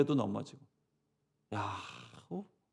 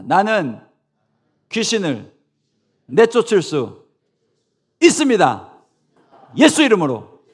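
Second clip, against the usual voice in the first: loud, high, slow proclaiming; bold declaration; 4 semitones higher, 13 dB louder than usual. Shown as a demonstration. Leading the congregation's repeat, loud bold declaration. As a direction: declare it with faith. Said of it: "따라해 보겠습니다".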